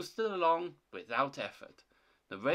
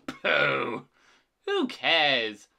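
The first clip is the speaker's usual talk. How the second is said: loud, dismissive pooh; scornful scoff; 7 dB louder, much slower than usual. Acted. Thomas dismisses Toby's ghost, scornful. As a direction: scoff it away boldly.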